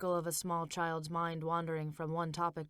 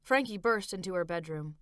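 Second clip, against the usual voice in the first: ordinary delivery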